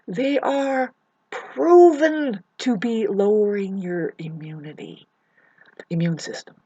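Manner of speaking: whisper. Acted. Warning viewers about narcissists, in a hushed whisper. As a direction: lean in and whisper the danger.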